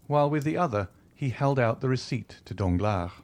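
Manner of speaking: ordinary delivery, nothing marked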